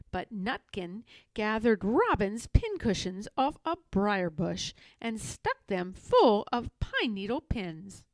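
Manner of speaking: usual speaking voice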